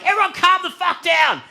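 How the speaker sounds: high-pitched yell for calm; high, strained shout; frantic command. Retold or imitated. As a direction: yell for calm while panicking yourself. Yelling at panicking friends.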